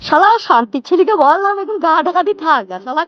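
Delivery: a father muttering about his son, lightly voiced; fretting father. Father laments, altered voice. (খানিক উঁচু গলায় চিন্তিত বাবা)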